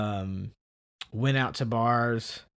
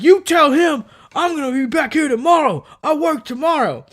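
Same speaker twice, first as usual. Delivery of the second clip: high, loud mock yell of a threat; angry threat; 14 semitones higher, 10 dB louder than usual. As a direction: shout the warning like a riled-up guy. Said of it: "uh, he's like".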